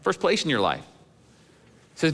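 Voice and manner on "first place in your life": higher-pitched, offhand mock request; mock casual suggestion. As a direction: float it like a timid, optional favor. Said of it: "He doesn't suggest it like".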